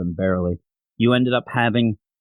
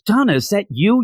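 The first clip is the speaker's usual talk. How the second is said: higher-pitched, mildly astonished acted greeting; surprised recognition; 7 semitones higher, 3 dB louder than usual. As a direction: act out spotting a long-lost girl. Reunion acted in a retelling.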